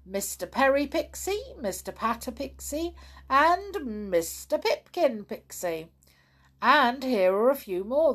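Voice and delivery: a hint of a jolly postman handing over parcels.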